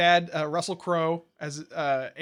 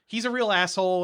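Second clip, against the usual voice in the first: ordinary talk, nothing marked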